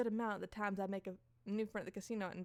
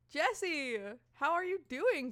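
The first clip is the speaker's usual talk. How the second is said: high, singing-out hello; effusive greeting; 6 semitones higher, 5 dB louder, slower than usual. Acted. [name] greeting her friend Jessie, effusive.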